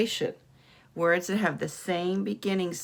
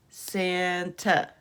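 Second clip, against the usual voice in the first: usual speaking voice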